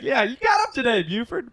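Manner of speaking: high, loud pet-talk; high-pitched encouraging dog voice; praising the dog. Retold or imitated. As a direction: cheer on an old dog, acted out.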